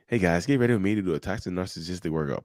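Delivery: usual speaking voice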